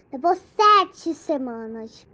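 usual speaking voice

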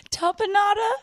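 loud, high questioning "Tapenada"; incredulous repeat. Real, not acted. Reading out an unbelievable pronunciation, incredulous.

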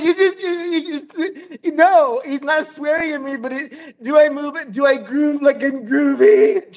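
high, stammering tearful voice; mock crying. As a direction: sputter it out as a sobbing schoolgirl. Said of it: "She's, like, crying".

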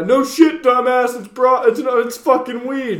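high, loud sassy comeback; bratty retort. Retold or imitated. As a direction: throw it back carelessly. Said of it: "But yeah".

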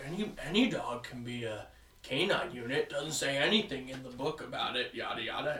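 put-on soft, lightly Irish old man; light Irish accent. Old Irish guy backing the rookie, soft Irish accent. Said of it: "that old Irish guy's like".

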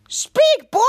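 loud, high-pitched order; acted shouted command. Ray demanding news from panting boy, mock impatient shout. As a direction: order it out loudly, pitch leaping.

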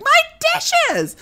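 high squeal of outrage over dishes; mock dismay. Samantha reacting to smashed dishes, high mock wail. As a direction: wail it like a diva losing her dishes. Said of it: "And then Samantha's like".

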